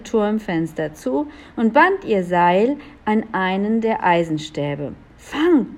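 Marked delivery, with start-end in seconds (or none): 5.2-5.7 raised, higher call. A narrator's reading. Elf throws a rope down to Riesig, calling out. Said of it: "rief sie zu Riesig hinunter".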